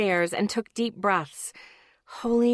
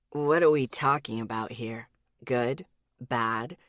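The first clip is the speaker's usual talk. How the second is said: man's voice; 9 semitones lower than usual. A narrator's reading. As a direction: lower male character voice. Ethan voiced by narrator.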